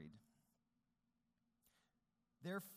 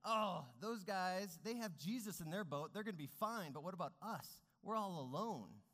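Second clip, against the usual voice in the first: higher, louder, worried complaining; upset other boat; 4 semitones higher, 6 dB louder than usual. Pretend. People in the other boats complaining in the storm, higher and louder. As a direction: fret out loud like someone left behind. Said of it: "Are they getting upset saying".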